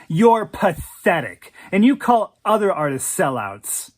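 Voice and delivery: loud, scornful tirade; furious accusation. Acted. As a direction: tear into him with contempt. She berates boyfriend in quarrel.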